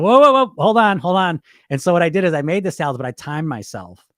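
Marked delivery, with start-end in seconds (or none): 0.0-1.4 sudden loud stop, pitched high; alarmed halt. Retold or imitated. Catching his lazy inner voice, loud alarmed whoa. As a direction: slam the brakes on his own excuse. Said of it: "I said".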